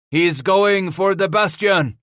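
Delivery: high, loud call-out landing on bastion; announced loudly. Acted. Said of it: "Henri Rem announced".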